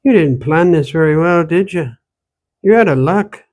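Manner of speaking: put-on old woman scolds the stranger in an Irish-Ontario accent. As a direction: tease him in the Irish-Valley accent. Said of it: "The accent she spoke with".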